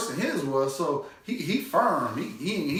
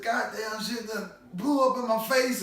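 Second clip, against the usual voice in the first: high mock complaint; imitating dad; 7 semitones higher than usual. Dad griping about his burnt face, high mock complaint. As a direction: whine it like the singed dad.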